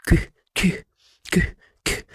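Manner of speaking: ك as a short breathy "k" burst; letter Q sound. Shown as a demonstration. Teacher showing how Q is pronounced, short K bursts. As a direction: pop each ك separately, not "kyu". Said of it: "الكيو بتنطق ازاي".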